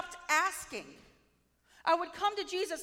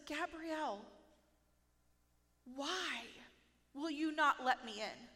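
softer, tender call by name; gentle Holy Spirit; 6 dB quieter than usual. Retold or imitated. Holy Spirit gently asks her to let him in, softly. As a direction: coax her gently to open up. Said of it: "so sweet, so gentle".

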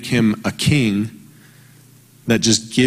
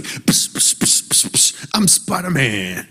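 web-shooting sound; slower than usual. Retold or imitated. Father imitating his son playing Spider-Man, whispered psst. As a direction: hiss like shooting webs. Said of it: "he's like".

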